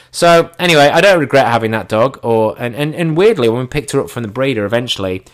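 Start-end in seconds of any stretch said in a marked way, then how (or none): none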